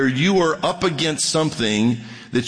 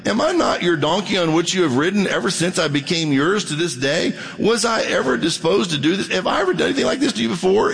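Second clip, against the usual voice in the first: rising higher, faster plea; the donkey protests, climbing higher; pleading donkey; 7 semitones higher, faster than usual. Acted. Donkey pleading with its master, higher and faster.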